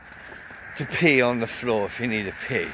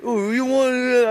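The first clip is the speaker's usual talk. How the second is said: mock challenge; 12 semitones higher, 4 dB louder than usual. Bloke challenging him.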